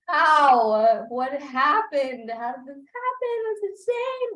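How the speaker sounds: high-pitched, drawn-out mock wail; hammed-up, stretched-out astonishment; retold shocked disbelief. Friends' retold shock at movie.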